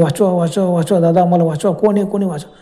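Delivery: ordinary delivery, nothing marked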